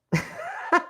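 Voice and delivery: squeaky high chipmunk giggle; a tiny, very high squeal of a laugh. Real, not acted. Streamer apologising to chat for her chipmunk giggle. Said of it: "sorry about the chipmunk".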